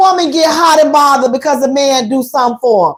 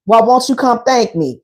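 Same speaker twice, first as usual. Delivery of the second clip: lower-pitched man's line; lightly voiced man, pitched down; man's casual invitation; 5 semitones lower than usual. Man asking the woman to thank him, low-pitched invitation. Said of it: "He's like".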